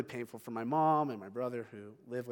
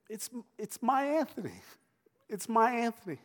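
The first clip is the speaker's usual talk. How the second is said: put-on higher, tender voice, like cooing to a baby; tender father; 9 semitones higher, 4 dB louder than usual. Father to his grown son at the dog, cooing tenderly. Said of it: "he's just saying so tenderly".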